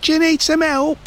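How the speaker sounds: high-pitched call over the fence; neighbour's put-on calling voice; Mister Brown. A narrator's reading. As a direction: call it out high, offering a hand. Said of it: "called Mister Brown".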